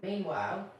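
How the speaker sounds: ordinary delivery, nothing marked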